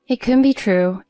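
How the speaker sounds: anguished disbelief. Acted. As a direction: blurt it out in shock. Disbelief at dying daughter.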